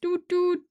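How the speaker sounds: buzzy kazoo toots, high; kazoo doots. Shown as a demonstration. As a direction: play two short kazoo notes. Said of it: "That was a kazoo".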